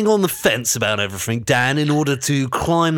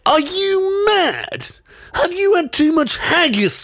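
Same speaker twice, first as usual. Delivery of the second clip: mock outrage; 11 semitones higher than usual. Mock outrage at Scottish suggestion.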